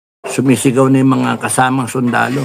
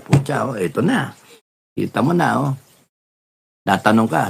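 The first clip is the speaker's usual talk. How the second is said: ordinary talk, nothing marked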